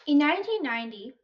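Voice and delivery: normal talking voice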